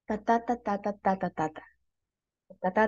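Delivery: "ta, ta, ta" in a steady metrical pulse, falling away at the end; rhythmic syllables. Shown as a demonstration. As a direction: tap out a line's meter on "ta", beats alternating. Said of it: "Yo pienso en mi cabeza".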